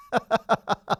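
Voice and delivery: short high-pitched chuckle; high laugh. Real, not acted. Coach amused by his forgetful customer act, high laugh. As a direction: laugh at your own joke.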